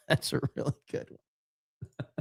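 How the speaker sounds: breathless laugh through the words; quiet laughing delivery. Real, not acted. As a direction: wheeze it out while laughing. Laughing at a thumbnail.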